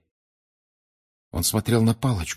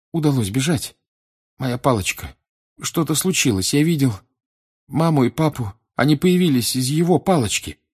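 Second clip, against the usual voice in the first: high, agitated, broken phrases; distraught boy; 7 semitones higher, 4 dB louder than usual. Acted. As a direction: relive the escape in distress. (потрясённый мальчик, высоко и сбивчиво)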